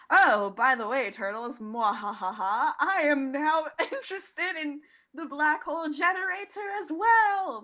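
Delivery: hammy villain voice, laughing through it; Lord Dreg impression. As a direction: ham up a cartoon space villain. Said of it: "That was a terrible impression of Lord Dreg".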